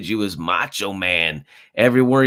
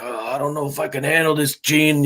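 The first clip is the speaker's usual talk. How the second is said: higher put-on wrestler voice, lightly done; imitating Macho Man; 8 semitones higher than usual. Retelling Macho Man talking to Mean Gene, a raised imitation voice. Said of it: "he's like".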